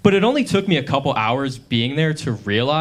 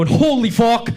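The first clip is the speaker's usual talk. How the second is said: Irish swearing; 7 semitones higher than usual. Retold or imitated. Cousin cut off in Dublin traffic, swearing in mock Irish. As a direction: swear at the driver in a hammed-up Irish accent. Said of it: "but swears in Irish".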